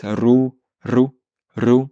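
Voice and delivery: "Ru" with a trilled r; rolled r. Shown as a demonstration. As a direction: roll the r in "ru". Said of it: "Essaye de bouger la langue".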